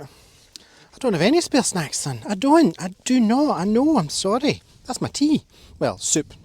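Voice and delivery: high, loud, drawn-out refusal to a pet. Real, not acted. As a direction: plead sweetly with the begging dog. Answering the begging dog.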